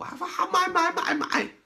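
high, hurried caving-in parent voice; parent giving in. Retold or imitated. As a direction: give in fast just to stop the crying. Parent caves to crying child.

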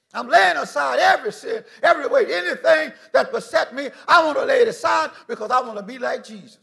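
rising, loud pulpit proclamation; full-force preaching. Acted. Preacher proclaims God's love to the congregation, loud and rising. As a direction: drive it home to the pews.

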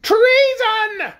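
very high, loud drawn-out shriek. A narrator's reading. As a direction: shriek the accusation like an outraged queen. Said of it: "shrieked Anne".

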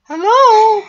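very high, loud sing-out; loud high-pitched questioning call; calling out hello. Acted. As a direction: call a drawn-out falsetto hello through a doorway. Calling into the classroom.

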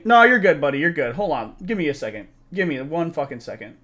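ordinary delivery

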